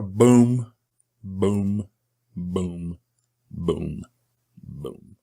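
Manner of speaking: boom, boom, boom dying out to nothing; slowing booms fading. Shown as a demonstration. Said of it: "it's a little slower".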